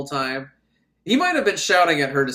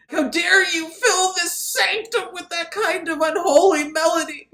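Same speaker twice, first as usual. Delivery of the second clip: outraged priest; 14 semitones higher, 4 dB louder than usual. Acted. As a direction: thunder with hammed-up holy outrage. Acted outraged priest scolding organist.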